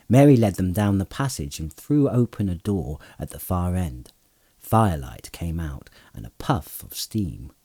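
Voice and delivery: ordinary delivery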